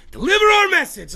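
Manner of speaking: high, loud "deliver our message" bellowed; hammed-up villain shout. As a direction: shout the order like a cartoon villain. Dog owner voicing commands.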